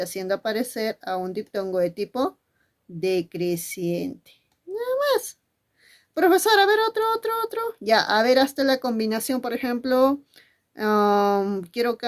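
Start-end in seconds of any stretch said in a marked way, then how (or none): 4.6-5.4 put-on high, child-like voice of a pupil answering; pupil's voice. 6.1-7.6 high, eager pupil calling for more; be the keen kid asking the teacher for another. Acted.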